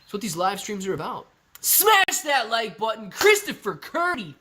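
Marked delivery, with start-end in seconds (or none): 1.6-4.4 slow, loud, higher hype call; loud streamer hype; hype shout. Real, not acted. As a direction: pump up the chat. Hyped streamer call to action.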